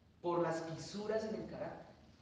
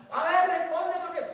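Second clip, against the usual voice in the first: taunting voice; 10 semitones higher, 8 dB louder than usual. Retold or imitated. Preacher mimics the devil's taunt, high and loud. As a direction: goad them into answering.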